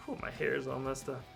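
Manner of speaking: normal talking voice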